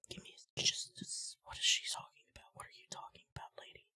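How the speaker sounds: barely audible baffled whisper; hushed, voiceless mutter of confusion; whispered aside. Real, not acted. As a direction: whisper it to yourself, very softly. Muttering aside about the character.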